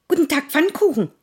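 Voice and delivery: hohe, schnelle Hasenstimme; Hase. Acted. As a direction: hastig wie ein Hase. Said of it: "sagte der Hase". (hastiger Hase)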